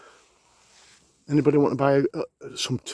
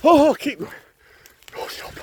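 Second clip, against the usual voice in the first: usual speaking voice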